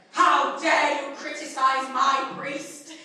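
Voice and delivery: loud, slow mock indignation; hammed-up furious defence; jealous boyfriend outrage. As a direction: bellow like an outraged protector. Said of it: "like a jealous boyfriend".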